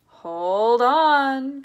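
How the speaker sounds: high, drawn-out holler; big stretched shout; loud cry. A narrator's reading. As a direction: cry a warning at full volume. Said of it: "cried Mister Wonka".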